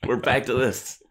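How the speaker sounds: exasperated. Retold or imitated. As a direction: throw it out like you're sick of this again. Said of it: "I was like".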